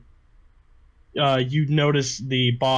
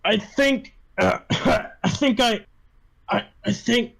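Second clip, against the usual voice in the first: halting and higher, broken by coughs; choking, dying speech; 7 semitones higher than usual. Acted. Poisoned boss collapsing mid-sentence at the table, choking. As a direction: struggle to get the words out between coughs.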